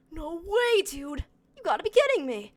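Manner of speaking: high-pitched, hammed-up amazement; mock disbelief. Streamer answering a chatter claiming to be the pilot, mock disbelief. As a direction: play astonished, pitching it way up.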